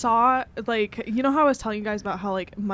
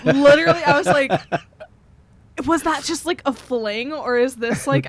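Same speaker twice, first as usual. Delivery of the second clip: excited gossip; 3 semitones higher, 7 dB louder than usual. Real, not acted.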